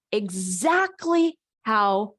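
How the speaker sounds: slow, deliberately separated words; slowly and clearly, with spaces between words; slow, clear articulation. Shown as a demonstration. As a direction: demonstrate careful articulation, drawing each word out. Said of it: "articulating them slowly and clearly".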